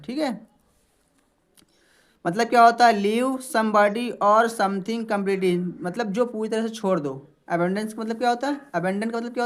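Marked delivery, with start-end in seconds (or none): 2.9-5.7 English read very slowly, word by word; slow dictation. Shown as a demonstration. Teacher reading a definition aloud to students, very slow. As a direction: spell it out slowly for the class.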